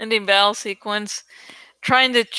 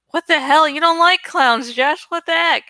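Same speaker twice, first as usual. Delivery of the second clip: mock shocked outburst; 6 semitones higher than usual. Mocking a viewer upset at Josh over clowns, high mock shock. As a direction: act scandalized at the clown talk. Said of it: "and, like".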